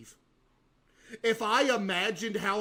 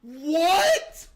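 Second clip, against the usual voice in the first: shocked what; 9 semitones higher, 8 dB louder than usual. Real, not acted. Reacting to trailer shot.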